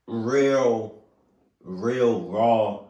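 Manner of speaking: "real, real raw" loud and drawn out; "real" repeated with slow, heavy emphasis; raw emotion. Real, not acted. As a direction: lean hard on "real" and "raw", pausing between. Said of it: "emotion that you all getting from me".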